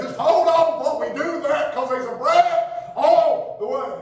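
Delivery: fervent Baptist preaching. Acted. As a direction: build the congregation up. Preaching at full force.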